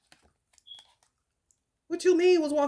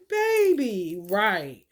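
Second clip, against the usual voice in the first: ordinary talk, nothing marked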